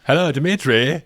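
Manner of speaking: imitating Sellers' Dimitri. Co-host quoting the President on the hotline to Moscow, mock-polite greeting. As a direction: lightly imitate an over-polite caller. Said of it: "Just the way he says Dimitri".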